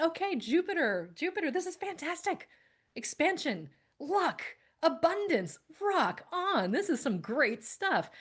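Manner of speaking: high-pitched, giddy retelling of past hopes; hammed-up, high, elated self-quote; mock excitement. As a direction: gush over each word like a prize. Acting out past excitement about Jupiter.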